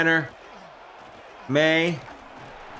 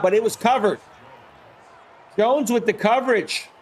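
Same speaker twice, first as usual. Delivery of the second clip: sportscaster calling a punt situation; live play-by-play; 10 semitones higher than usual. Acted. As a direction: call the action as it happens, fired up.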